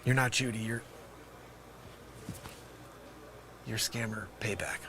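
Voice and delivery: low, hushed, halting disbelief; small, deflated voice with long pauses; stunned realization. Acted. Stunned realization of who calls.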